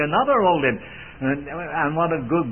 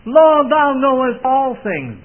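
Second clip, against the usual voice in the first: higher, louder, slow plea; heartbroken Peter; 8 semitones higher, 6 dB louder, slower than usual. Acted. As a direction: pour out a broken heart. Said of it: "and it broke his heart".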